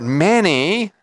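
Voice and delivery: "many" drawn out and pitched high; emphasis on many. Real, not acted. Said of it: "I highlight that word".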